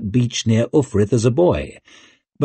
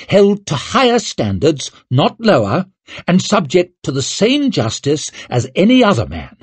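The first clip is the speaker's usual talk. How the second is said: raised, stern royal rebuke; angry snap; 8 semitones higher, 3 dB louder than usual. A narrator's reading. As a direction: lay down the law, voice high. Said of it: "snapped Orso, glowering".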